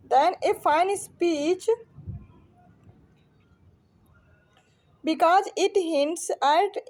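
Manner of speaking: slow, deliberate read-out of a quotation; slow, halting dictation-style reading; reading aloud. Acted. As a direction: read it out word by word with long pauses. Reads out exam question.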